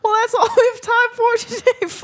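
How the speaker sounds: high, put-on presenter voice; guest-host sign-off. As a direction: wrap up the show brightly in character. Co-host signs off in character.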